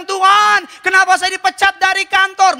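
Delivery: high, loud protest to God; cry of complaint. Acted. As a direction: wail the question up to heaven. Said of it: "kau teriak". (teriakan protes kepada Tuhan)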